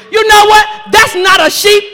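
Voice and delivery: ordinary talk, nothing marked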